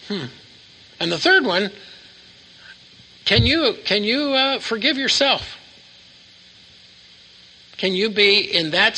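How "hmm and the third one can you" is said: ordinary talk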